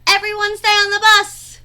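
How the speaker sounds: high, loud teacher's shout to the class; a teacher shouting a firm order. Acted. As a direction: call it out loud over the noise. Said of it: "shouted the Frizz".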